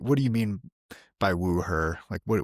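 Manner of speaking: ordinary talk, nothing marked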